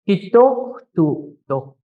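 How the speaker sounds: "Hitomo" dragged slowly, every syllable stretched; slow drawn-out. Shown as a demonstration. Teacher drawing out "Hitomo" slowly to show the class. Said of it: "when you say it slow".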